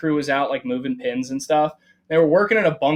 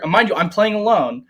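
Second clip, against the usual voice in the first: ordinary delivery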